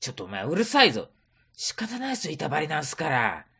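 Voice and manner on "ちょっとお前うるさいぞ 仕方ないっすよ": ordinary delivery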